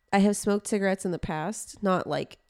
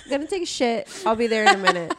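laughing mock quote; 5 semitones higher, 5 dB louder, faster than usual. Voicing the model, joking.

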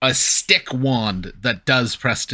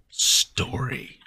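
unvoiced whisper; 4 dB quieter than usual. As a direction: breathe the word out. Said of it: "Mary's like".

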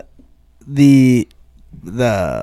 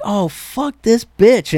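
higher, drawn-out retold exasperation; retold anger; 6 semitones higher, slower than usual. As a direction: let out slow, stretched mock indignation. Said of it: "more anger than humor".

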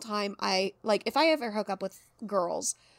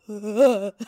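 disgusted groan; 8 dB louder than usual. Retold or imitated. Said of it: "made neurodivergence go".

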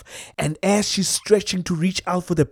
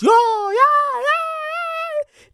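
much higher squealing yeah; excited whoop; much slower than usual. Real, not acted. Watching the buffalo panic, a high excited squeal. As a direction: squeal with glee as they scatter.

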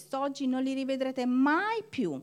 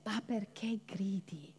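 much softer, low, slow question; God's calm rebuke; 5 semitones lower, 12 dB quieter, slower than usual. Retold or imitated. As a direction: ask it quietly and gently. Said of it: "che gli dice".

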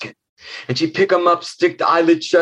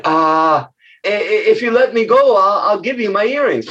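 high, stammering offer; nervous little girl; 6 semitones higher than usual. Acted. Girl bargaining with the monster, high nervous stammer. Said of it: "She said".

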